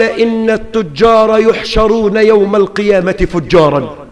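ordinary delivery

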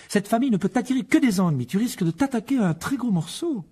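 high-pitched, anxious wifely caution; warning wife. Acted. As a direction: warn your husband off, high-voiced. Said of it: "avertit Madame Weasley". (épouse inquiète, voix aiguë)

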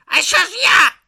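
loud, high-pitched whine; whining. A narrator's reading. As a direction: wail it like someone left out. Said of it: "заскиглив Ом".

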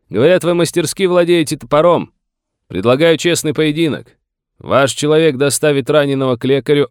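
high, loud, carrying proposal; shouted challenge. A narrator's reading. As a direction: call out a duel offer across the distance. Morgan shouts a challenge from afar.

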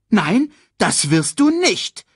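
high, loud, drawn-out refusal; indignant hyena quarrelling loudly; angry scolding. A narrator's reading. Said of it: "schimpfte Banzai".

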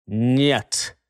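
low, slow, heavy refusal; slow grim no. Acted. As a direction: drag out a grim no. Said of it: "ещё раз медленно повторил".

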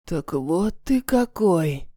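slow, low and calm; cool unhurried composure. Acted. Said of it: "спокойно говорит Наиль".

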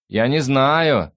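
slow, high, loud drawl. A narrator's reading. The fox answers across the flat, drawling loudly. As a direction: stretch the words out high. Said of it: "протянула лиса".

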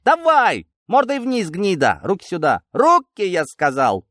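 high, loud barked orders; bully a prisoner with shouted orders; furious sergeant shouting, pitch way up; angry command. Acted. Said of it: "зло скомандовал сержант".

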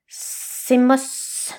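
whispered pouting snake voice; sulky snakelet. A narrator's reading. Snakelet sulking at the name, whispered. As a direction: sulk under the breath. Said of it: "bouda Serpenteau".

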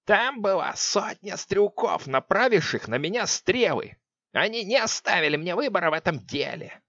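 cold, menacing witch voice; icy witch voice. Acted. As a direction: chill every word. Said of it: "слова с леденящей интонацией".

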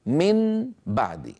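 من بعد drawn out slowly, N distinct before B; clear unmerged nun. Shown as a demonstration. Teacher has students test it by mouth, clear nun. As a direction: stretch من high and slow, keeping a true N. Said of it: "انطقوا نونا مظهرة".

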